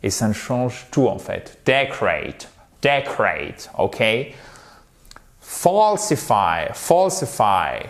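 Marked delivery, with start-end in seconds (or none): 1.6-3.7 "decorate" as DEK-rate, falling; correct English pronunciation. Shown as a demonstration. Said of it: "non, c'est to decorate". 5.4-7.9 slow "Falsify", long open AW in FAWL; throaty English vowel. Shown as a demonstration. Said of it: "ça se fait vraiment avec la gorge".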